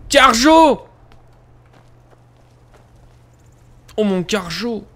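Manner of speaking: high delighted call; thrilled exclamation, high; excited cry. Real, not acted. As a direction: shout the name with glee. Delighted at game item.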